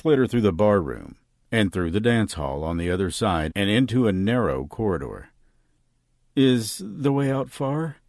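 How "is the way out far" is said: higher-pitched, slow, timid question; quiet, scared. A narrator's reading. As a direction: ask slowly, afraid of being overheard. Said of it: "she asked quietly, scared someone".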